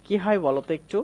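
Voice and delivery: ordinary talk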